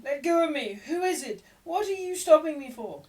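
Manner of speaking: put-on high, loud panicked boy's voice; a boy fighting free, crying out high and loud; frightened boy shouting at a stranger; struggling boy. A narrator's reading.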